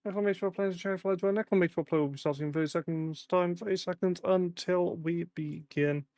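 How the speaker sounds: fast, high announcer read. Acted. Announces join command to chat.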